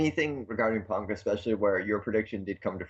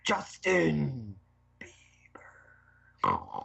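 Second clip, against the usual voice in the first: brief wrestler promo voice, trailing off; Ultimate Warrior voice; 4 semitones higher, much slower than usual. Retold or imitated. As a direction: hype it, then fade to a whisper. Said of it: "in the Ultimate Warrior voice".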